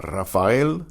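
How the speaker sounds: Rafael opening on a rolled R; Spanish Rafael with a trilled start; trilled initial R. Shown as a demonstration. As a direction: roll the first R of Rafael. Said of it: "The initial R is also".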